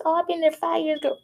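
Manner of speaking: imitated girlfriend chat, high-pitched; mimicking a woman's reply. Acting out a woman's boastful reply.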